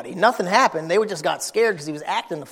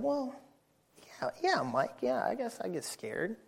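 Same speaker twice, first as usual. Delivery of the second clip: much softer, gentle admission; father gently answers his scared son; soft reply; 3 semitones higher, 14 dB quieter than usual. Retold or imitated. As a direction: answer the boy quietly. Dad answering softly.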